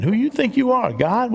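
high mocking rise on who do you think you are; sarcastic. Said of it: "sarcastic question".

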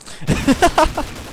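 high-pitched rising laugh; amused giggle. Real, not acted. Laughs at hacking accusation.

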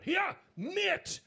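Geordie heckler. Retold or imitated. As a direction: shout it from the back like a Geordie lad. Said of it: "a Geordie lad at the back".

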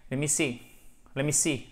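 "Let me see" slurred into one quick chunk; quick linked phrase. Shown as a demonstration. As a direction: glide the three words together. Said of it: "au lieu de détacher let me see".

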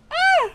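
a very high, loud startled cry; sharp yelp. Real, not acted. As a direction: yelp in shock. Sudden yelp in game.

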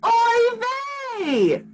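high wailing oy vey; cried out. Acted. Mother fish wailing after the taxi takes her child, high. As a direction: cry it out like a despairing mother. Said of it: "Mama Gefilte cried out".

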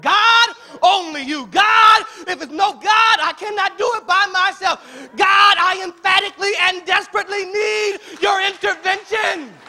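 full-force shouted plea to God; desperate prayer at the top of the voice. Acted. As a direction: cry out for intervention, high and loud. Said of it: "and you're desperate".

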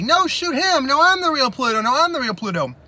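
high, loud desperate protest; panicked clone claim. Retold or imitated. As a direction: plead frantically not to be shot. Two Plutos each claiming realness.

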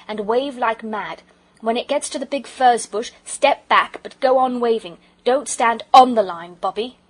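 loud, emphatic orders; urgent commands. A narrator's reading. Peter instructing Bobby beside the line, urgent and commanding. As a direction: call out instructions, punching the key words.

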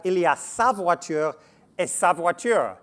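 French read with an English accent. Shown as a demonstration. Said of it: "apologies for my poor French accent".

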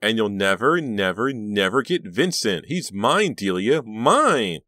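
high, loud wail, stretching the last word; hysterical possessive rage. A narrator's reading. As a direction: claim it fiercely against a rival. Rival's hysterical possessive tirade.

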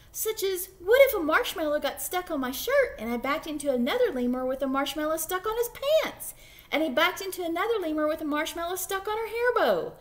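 fast, rising worried babble; nervous rambling. Acted. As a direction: rush it out, spiralling into panic. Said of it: "his voice trailed off".

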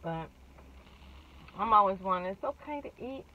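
small bite in mouth; speaking between chews; talking while chewing. Real, not acted. Seen 0: Holds bitten sandwich, lips closed, still chewing after recent bite.

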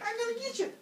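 very high chasing taunt; high cartoon pursuit voice; menacing-playful Kronos. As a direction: call it out high as you give chase. Kronos chases Father Sky.

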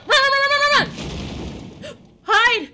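loud frantic shouting; being chased. Real, not acted. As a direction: yell run while fleeing.

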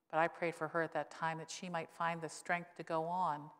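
ordinary delivery

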